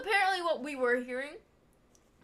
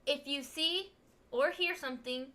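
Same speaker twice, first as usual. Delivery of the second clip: usual speaking voice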